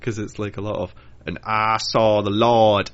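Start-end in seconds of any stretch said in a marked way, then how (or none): 1.2-2.9 slow, loud mock preacher. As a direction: boom it out like a backwoods preacher. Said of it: "I don't think they actually speak like that".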